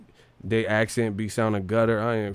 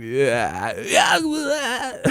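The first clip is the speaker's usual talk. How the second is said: hyped yell; 13 semitones higher than usual. Retold or imitated. Hyped shout in imagined [name] voice.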